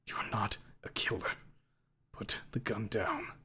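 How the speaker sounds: soft, slow whisper; whispered plea. A narrator's reading. Ripley whispering to Lucy to drop the gun.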